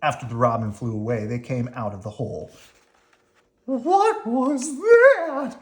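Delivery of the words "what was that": very high, slow, loud frightened worm squeal; frightened cry. Acted. Slimy after the robin attack, in a very high frightened squeal. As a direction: cry out in shock. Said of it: "cried Slimy".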